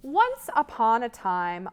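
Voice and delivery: usual speaking voice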